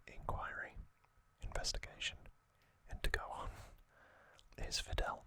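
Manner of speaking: normal talking voice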